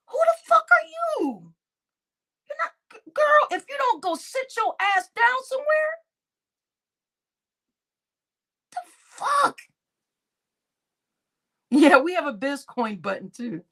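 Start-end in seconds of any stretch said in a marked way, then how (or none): 0.1-9.6 high, screaming fury; furious shout. Retold or imitated. Woman yelling at a viewer, furious high scream.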